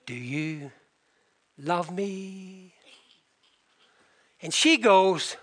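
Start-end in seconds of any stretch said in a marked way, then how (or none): 0.1-2.7 soft, hushed and hesitant; tender question. Retold or imitated. Husband shyly asking his wife of years, soft and tender. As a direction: ask it gently, almost afraid.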